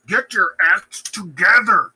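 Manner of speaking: loud, high, slow rebuke; mock scolding. Lady Macbeth rebuking her panicking husband, loud mock scolding. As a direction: hammer it out as an exasperated wife.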